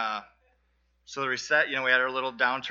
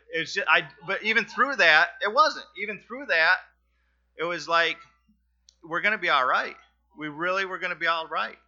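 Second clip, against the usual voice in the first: emotional swell; 6 semitones higher, 7 dB louder than usual. Real, not acted. Telling the church they'd be all right, voice surging with emotion. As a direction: voice climbing higher and louder with feeling. Emotional testimony about job loss.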